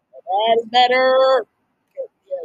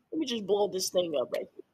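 ordinary talk

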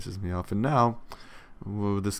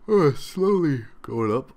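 slow, higher and louder, stretched by a yawn; yawning through words; 6 semitones higher, 6 dB louder, slower than usual. Real, not acted. Gamer yawning while checking his batteries, yawning. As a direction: let a yawn drag the words out.

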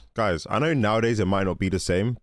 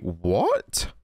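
ordinary delivery

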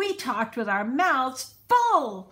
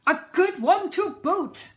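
higher, louder gruff chorus of pirates; pirate crew agreeing; 8 semitones higher, 7 dB louder than usual. A narrator's reading. Crew echoing the captain about the new digger, rowdy agreement. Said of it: "the others agreed".

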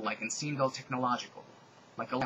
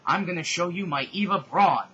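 louder, cocky battle taunt; 4 semitones higher, 8 dB louder than usual. Acted. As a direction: throw the line at the villain.

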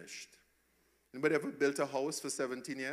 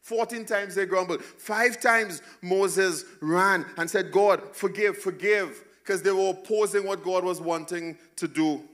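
fervent preaching; 6 semitones higher, 7 dB louder than usual. Acted. Preaching at full force.

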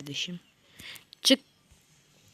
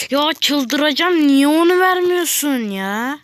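higher, louder, slowed whine; drawn-out exasperated cry; frustrated outburst; 7 semitones higher, 6 dB louder, slower than usual. Real, not acted. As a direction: complain to the game in exasperation. Raging at game loot.

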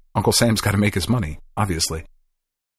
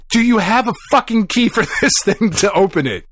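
exasperated demand; 9 semitones higher than usual. Retold or imitated. Demanding a key again.

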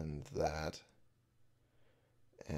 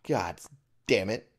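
loud, raised-pitch complaint; exasperated griping; 4 semitones higher, 8 dB louder than usual. Real, not acted. Player griping about neutral spirits, loud exasperation. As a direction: gripe loudly at rotten luck.

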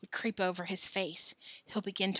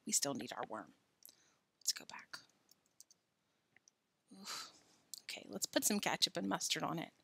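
usual speaking voice

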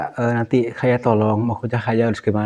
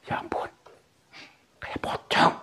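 hushed, voiceless awe; very soft, breath-only aside; frightened whisper; 7 dB quieter than usual. Real, not acted. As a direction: whisper it in dread. Frightened aside on seeing ghost.

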